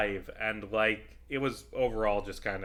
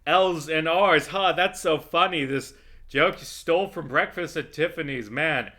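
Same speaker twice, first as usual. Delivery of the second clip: loud, higher mocking fake laughter; sarcastic mock laugh; 5 semitones higher, 7 dB louder than usual. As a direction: sneer at the lazy joke by playing it up. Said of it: "making fun of the".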